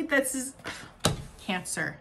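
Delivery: ordinary talk, nothing marked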